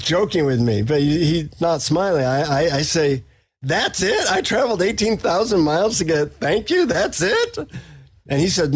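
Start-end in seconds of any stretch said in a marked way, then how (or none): 3.6-7.6 higher-pitched, indignant disbelief; incredulous protest. Retold or imitated. Let-down traveler protesting to the guru, incredulous. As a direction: pitch leaps up in outraged questioning.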